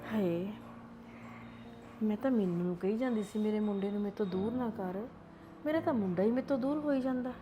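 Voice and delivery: lonely mother lamenting her son's leaving; sorrowful lament. Acted. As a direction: mourn quietly, left alone. Grandmother alone, lamenting.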